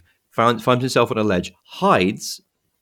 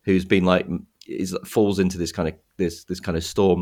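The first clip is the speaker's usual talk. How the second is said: ordinary talk, nothing marked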